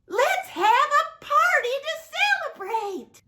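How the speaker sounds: high, laughing, celebratory; joyful announcement. Acted. Father calls for a party, high and laughing. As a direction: announce it with glee.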